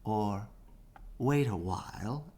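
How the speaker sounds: soft, sad crocodile plea; sad and quiet. Acted. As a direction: ask gently with a sad smile. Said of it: "with a sad little smile".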